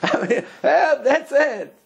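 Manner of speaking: high, laughing yell for help; mock cry for help. Trapped groom calling for help, half laughing. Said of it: "There's scream".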